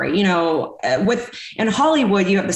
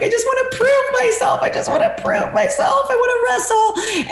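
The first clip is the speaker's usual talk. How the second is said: mock sobbing shout. Begging to wrestle after the count-out win, hammed-up sobbing cry. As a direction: wail it like a devastated kid, much higher. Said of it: "And I'm like".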